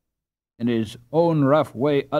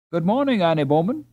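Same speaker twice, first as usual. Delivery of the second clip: higher, louder friendly hello; pleasant greeting; 7 semitones higher, 4 dB louder, faster than usual. A narrator's reading. Hans greeting Annie on the canal, pleasant and higher. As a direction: greet her cheerfully. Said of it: "said a pleasant voice".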